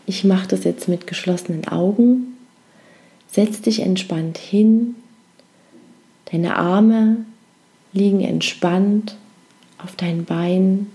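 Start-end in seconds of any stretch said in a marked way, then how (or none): 3.3-10.9 guided meditation. Acted. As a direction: lull them gently into rest.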